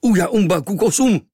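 loud, high savage yell; dreadful screech. A narrator's reading. Tattooed stranger wakes the sleeping boy, screeching. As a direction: scream gibberish to jolt a sleeper. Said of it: "příšerné zaječení".

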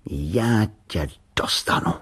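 slow, menacing threat; slow and ominous; dangerous voice. A narrator's reading. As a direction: threaten it slowly, word by word. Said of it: "řekl nebezpečným hlasem".

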